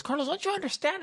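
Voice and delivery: high-pitched imitation of an angry teacher; mock exasperated teacher. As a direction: act out a teacher at the end of his patience. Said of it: "he got hella mad".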